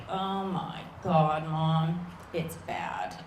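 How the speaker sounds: low, slow, heavy groan to Mom; acted world-weary kid; grim dread. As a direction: sink low and drag out the bad news. Kid grimly reports on class.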